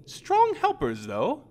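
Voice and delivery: high, slow, sly purr; Henrika the vampire. Acted. Vampire mocking the grieving priest, high and sly. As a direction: toy with him, amused.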